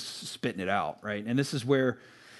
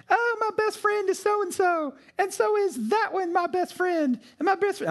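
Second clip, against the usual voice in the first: high, gushing little-sister voice, much higher; mocking little sister. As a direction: mimic a kid sister gushing about every best friend. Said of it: "she was like".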